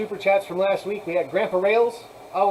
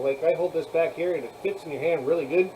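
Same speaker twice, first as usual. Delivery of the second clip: normal talking voice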